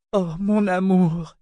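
slow, high-pitched weeping lament; drawn-out tearful moan. A narrator's reading. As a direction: cry for the one you love. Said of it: "dit-elle en pleurant".

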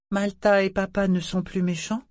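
put-on high, small child asking hopefully; child's voice. A narrator's reading. Little boy asks the family if the fight is over, in a high child's voice. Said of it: "demanda une voix enfantine".

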